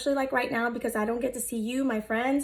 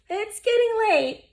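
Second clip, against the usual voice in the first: higher, louder Amos line; bright character voice; 10 semitones higher, 5 dB louder than usual. Acted. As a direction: lift it up brightly. Amos winds alarm clock.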